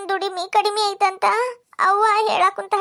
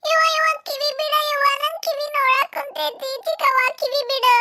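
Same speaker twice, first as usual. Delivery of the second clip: put-on much higher child's pleading cry; little boy in pain; 10 semitones higher than usual. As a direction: beg her to let go of your ear. Boy cries as ear is twisted. (ಕಿವಿ ಹಿಂಡಿದಾಗ ಮಗುವಿನ ಅಳು)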